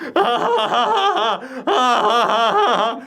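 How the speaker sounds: long, high-pitched mock laugh; creepy hillbilly laugh. Dale awkwardly greets the college kids, creepy high laugh. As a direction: force out a drawn-out, unsettling cackle.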